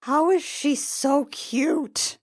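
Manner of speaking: slow, high, loud gush. Real, not acted. Player gushing about the teen, high and loud. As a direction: melt over how cute she is. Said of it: "player gushing".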